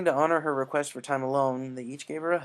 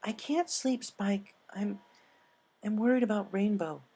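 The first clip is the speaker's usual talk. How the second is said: higher, fretful pony voice; worried Twilight; 8 semitones higher than usual. Acted. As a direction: Twilight frets to Spike about Rainbow.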